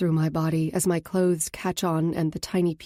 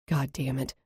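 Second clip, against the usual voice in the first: ordinary delivery, nothing marked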